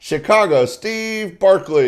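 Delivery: big, rising showman announcement; announcer intro. Acted. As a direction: hype the crowd like an emcee. Host announcing the act's name.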